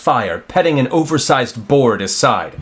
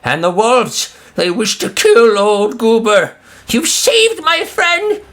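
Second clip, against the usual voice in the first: goblin voice; 9 semitones higher than usual. Acted. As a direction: gush like a tearful goblin. Goblin thanks them.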